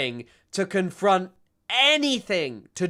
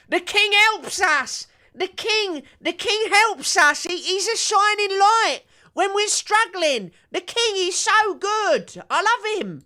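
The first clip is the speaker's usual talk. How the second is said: very high-pitched, drawn-out mock idiot voice; mocking gullible royalist; 5 dB louder than usual. Streamer mocking idiots who adore the king, shrill fawning caricature. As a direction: squeal loud adoration like a dim-witted superfan. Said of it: "all of these idiots who are like".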